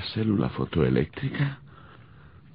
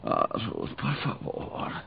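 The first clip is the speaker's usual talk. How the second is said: whispered, soft plea; pleading whisper; 6 dB quieter, much slower than usual. Acted. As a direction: breathe the plea, barely voiced. Ruth pleading, half-asleep.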